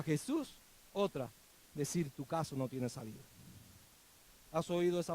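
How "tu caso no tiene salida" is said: low, hushed discouraging voice; voz desalentadora. Acted. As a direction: say it quietly and low, like a defeating thought. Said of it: "esa voz". (voz baja y desalentadora)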